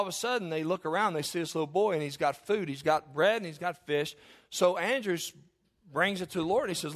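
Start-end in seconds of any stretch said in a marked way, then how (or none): none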